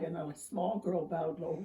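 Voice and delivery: usual speaking voice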